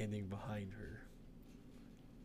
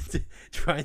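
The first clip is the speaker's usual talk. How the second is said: laughing noise; 5 semitones higher, 12 dB louder than usual. Real, not acted. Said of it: "Did you just make a noise?".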